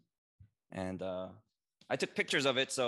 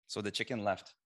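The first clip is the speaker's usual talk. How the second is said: normal talking voice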